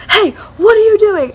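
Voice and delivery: high-pitched startled-owner challenge; mock challenge. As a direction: imitate someone catching trespassers. Homeowner catches them with flashlight.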